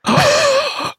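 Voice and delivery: high, sharp gasp of disbelief; a real shocked intake of breath. Real, not acted. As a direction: gasp out loud at the twist. Reacts to the story's twist.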